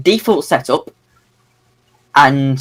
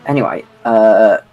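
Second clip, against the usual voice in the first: condescending; 4 semitones lower than usual. Real, not acted. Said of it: "really said anyway condescendingly there".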